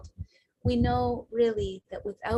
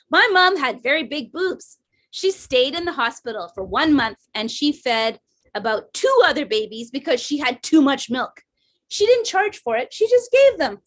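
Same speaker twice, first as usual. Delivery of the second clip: emphatic imitation of mom, rising higher and louder; mom's way of talking; 4 semitones higher, 6 dB louder than usual. Mom answering her daughter about breastfeeding, loud emphatic imitation. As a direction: play mom boasting frankly about her own mother. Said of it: "this is the way my mom talked".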